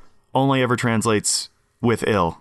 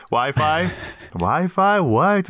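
puzzled Wi-Fi; 7 semitones higher, much slower than usual. Pretend. Mock puzzled echo.

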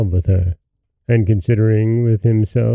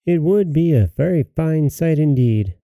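put-on higher, scornful girl's voice; ill-bred minx; 5 semitones higher than usual. A narrator's reading. Minx refusing her mother's errand, ill-bred scorn. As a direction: sneer lightly like a spoiled daughter. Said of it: "said this ill-bred minx".

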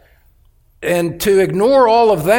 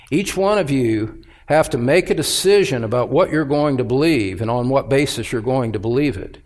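normal talking voice

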